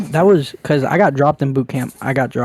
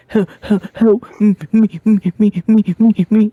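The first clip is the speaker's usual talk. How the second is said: higher, whiny cries for help; imitating crying little brother; 6 semitones higher, 4 dB louder than usual. Mocking his crying little brother begging for help, whiny and higher. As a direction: plead like a scared kid. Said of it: "He's like".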